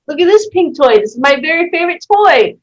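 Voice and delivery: bright shop clerk hawking her favorite toy; salesy pitch. Retold or imitated. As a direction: push the toy like a cheery clerk. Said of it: "still trying to sell her a toy".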